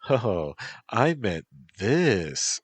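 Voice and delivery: put-on coy valley girl drawl.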